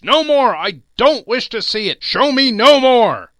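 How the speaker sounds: frantic, anguished outcry; anguished shout. A narrator's reading. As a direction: scream it, desperate. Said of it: "cried Scrooge".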